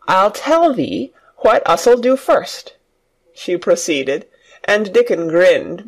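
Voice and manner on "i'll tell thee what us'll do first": slow, higher, carefully twisting into Yorkshire; a girl proudly trying the dialect. A narrator's reading. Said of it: "tried to twist her tongue into speaking Yorkshire".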